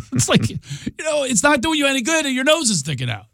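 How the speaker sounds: laughing rant. Acted. Mocking mask wearers, laughing.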